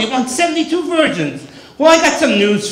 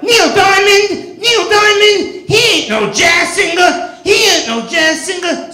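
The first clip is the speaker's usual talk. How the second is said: put-on indignant shout of an offended girlfriend; hammed-up angry girlfriend yell; girlfriend's outburst; 9 semitones higher than usual.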